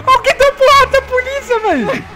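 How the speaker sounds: high, laughing words; laughing while talking. Real, not acted. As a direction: laugh through the line. Laughing at the police crash.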